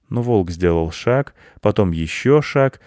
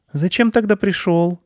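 put-on high-pitched child's question; little boy reproaching a friend; puzzled boy; 12 semitones higher, 3 dB louder than usual. A narrator's reading. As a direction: ask it high like a concerned child. Boy questions the frightened hare.

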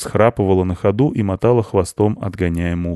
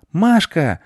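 put-on boy crying out; 10 semitones higher than usual. A narrator's reading. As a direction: blurt it out like an eager boy. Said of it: "выкрикнул мальчик".

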